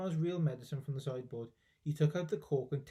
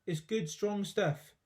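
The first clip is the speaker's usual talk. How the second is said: slow reassuring; 4 semitones higher, slower than usual. Acted. George reassuring.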